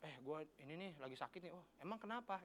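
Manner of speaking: a hushed everyday Jakarta dialogue; understated quiet chat. Retold or imitated. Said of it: "kalau misalkan lu di Jakarta".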